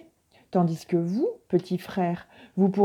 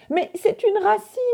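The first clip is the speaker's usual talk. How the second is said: much higher sudden exclamation of surprise; surprised cry; 12 semitones higher than usual. A narrator's reading. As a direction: gasp out the discovery. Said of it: "s'écria Vieil Homme Coyote". (s'écrie, très surpris)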